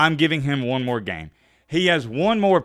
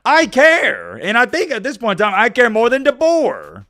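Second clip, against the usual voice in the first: real heated insistence, pitched high; passionate; 9 semitones higher, 6 dB louder than usual. Real, not acted. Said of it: "most passionate and hyped up I've been".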